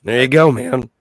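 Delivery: hoarse, laryngitis rasp; raspy voice. Real, not acted. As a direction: voice like a swallowed fire hose. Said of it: "raspy enough where it's almost like a laryngitis".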